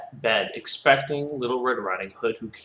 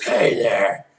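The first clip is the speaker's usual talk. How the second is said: put-on hoarse grandma, louder; sick grandma's voice; 5 dB louder, slower than usual. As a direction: greet them like an ailing grandmother. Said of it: "deep voice 'cause she was sick".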